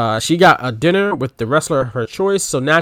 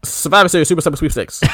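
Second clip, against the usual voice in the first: quick, higher run through the whole title; fast tongue twister; 4 semitones higher, faster than usual. Shown as a demonstration. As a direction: rattle it off without a break. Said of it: "Say that five times fast".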